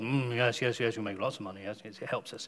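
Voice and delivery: low, soft, quick muttered agreement; murmuring crowd. Retold or imitated. Traders nodding along to the silversmith, low murmured agreement.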